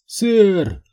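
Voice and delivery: high, loud terrified begging; desperate pleading. Acted. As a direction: beg for your life. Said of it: "отчаянно закричал раненый".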